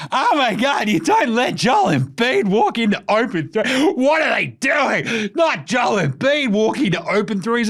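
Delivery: hammed-up excited broadcaster shouting; mock Doris Burke. Podcaster imitates commentator gushing over Embiid, high mock shout. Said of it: "She's like".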